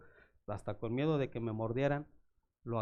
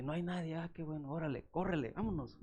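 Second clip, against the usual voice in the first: soft coaxing; 7 dB quieter than usual. Retold or imitated. Calls dog softly in park.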